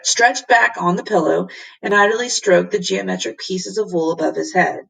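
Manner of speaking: plain reading voice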